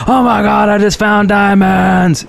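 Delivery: high excited shout; excited yell. Retold or imitated. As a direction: yell it like you struck gold. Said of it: "they always yell".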